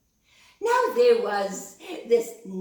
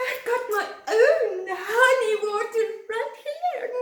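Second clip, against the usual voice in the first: much higher, louder sly rabbit voice; squeaky rabbit; 13 semitones higher, 7 dB louder than usual. Acted. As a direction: play the cheeky rabbit, high. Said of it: "Kalulu voiced, tempting".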